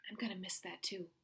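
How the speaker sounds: soft tender whisper. Acted. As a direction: confide it gently to your daughter. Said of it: "Mama whispered".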